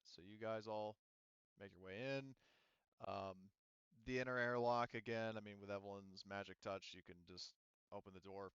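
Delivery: normal talking voice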